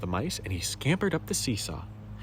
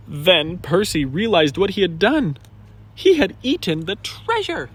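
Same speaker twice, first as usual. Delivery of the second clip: louder, higher gasping narration; dramatic realization; 4 semitones higher, 10 dB louder than usual. A narrator's reading. Narrator revealing Percy ate the treasure, dramatic. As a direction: build the shock of the mistake.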